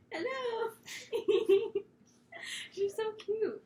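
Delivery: high cooing pet voice. Real, not acted. Cooing at the dog on camera, baby talk. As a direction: coo at the puppy.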